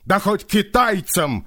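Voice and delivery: sharp angry retort; bark. Acted. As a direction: snap it out angrily. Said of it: "рявкнул Григорий". (рявкнув)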